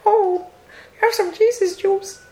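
high, slow pop-star coo; [name] voice. Pretend. Continues [name] bit.

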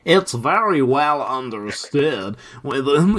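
mock Californian drawl, slowed; lightly put-on West Coast voice; Californian accent. Shown as a demonstration. Reading the quote in a doctor's Californian accent. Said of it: "he's from California, right?".

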